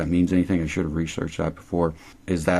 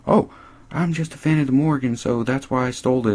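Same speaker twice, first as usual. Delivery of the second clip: higher-pitched put-on innocence; mock excuse; 6 semitones higher than usual. Host acting Zoe excusing herself, mock-innocent higher voice. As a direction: imitate a lame excuse, pitched up. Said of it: "She's like".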